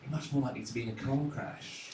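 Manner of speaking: hushed, breathy fellow passenger soothing; mock reassurance. Stranger on a plane mock-soothing a nervous flyer, hushed. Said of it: "someone will always say something along the lines of".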